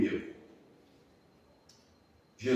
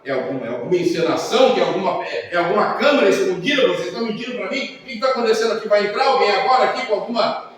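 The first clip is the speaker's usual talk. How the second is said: incredulous questions; 8 semitones higher, 7 dB louder, much faster than usual. Retold or imitated. Pastor suspects a prank.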